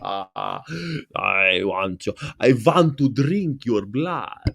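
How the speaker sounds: slow, hushed vampire voice; mock vampire. Hosts mocking blood talk with a slow vampire line. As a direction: drawl it out like a cartoon vampire. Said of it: "Duck, Duckula".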